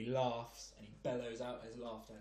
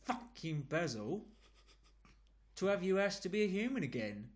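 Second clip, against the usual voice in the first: put-on London geezer accent; 2 semitones higher than usual. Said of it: "in a London accent, like a proper geezer".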